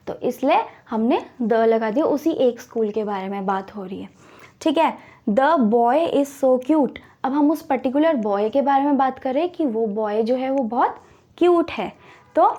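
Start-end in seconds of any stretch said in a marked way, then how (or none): 5.3-7.0 slow example read. Shown as a demonstration. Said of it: "teacher reads example sentence".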